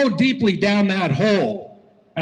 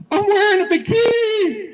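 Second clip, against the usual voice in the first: imitated woman's yell; 13 semitones higher, 4 dB louder than usual. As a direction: squeal it out as her, for the punchline. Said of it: "I heard her yell".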